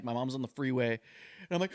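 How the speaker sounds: normal talking voice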